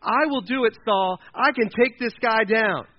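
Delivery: loud, high, eager volunteering; on fire, passionate. Acted. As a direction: burst out like a fired-up young hero. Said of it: "it's like on fire".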